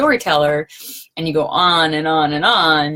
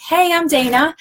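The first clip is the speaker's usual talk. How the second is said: bright greeting; 10 semitones higher, 3 dB louder than usual. Retold or imitated. Greeting class guests, bright host voice.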